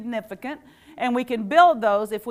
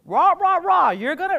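high, loud mock parent lecture; a parent lecturing about the future, higher and louder; 7 semitones higher, 7 dB louder than usual. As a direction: nag like a parent droning on. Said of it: "your parents would say".

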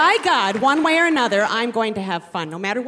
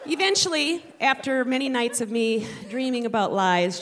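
ordinary delivery

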